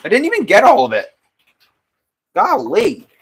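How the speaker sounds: high, loud sudden surprise; surprised. Real, not acted. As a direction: yelp of surprise at the clay. Surprised at missed cut.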